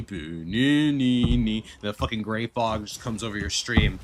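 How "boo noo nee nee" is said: droning, slowed-down boo-noo-nee-nee; lazy sing-song mock drone; slow mock chant. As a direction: drag the nonsense syllables out slowly.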